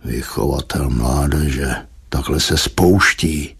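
low, slow disapproving grumble; dragged-out low grumble of disapproval; grumbling. A narrator's reading. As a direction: grumble it slowly, deep under your breath. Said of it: "zabručel".

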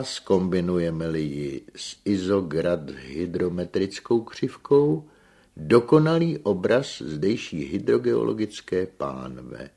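loud, high learned lecture with emphatic R; mightily scientific, noisy R. A narrator's reading. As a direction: roll out the technical terms grandly, Narbonne-style R. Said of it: "zesílená ještě rámusivým R, jež je vlastní narbonskému přízvuku".

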